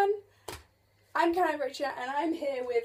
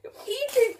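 laughing; 8 semitones higher, 10 dB louder, slower than usual. Retold or imitated. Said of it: "I was like".

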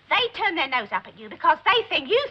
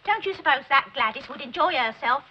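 ordinary talk

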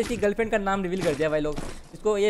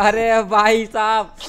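drawn-out exasperation; 2 semitones higher, 7 dB louder, much slower than usual. Real, not acted. Exasperated exclamation.

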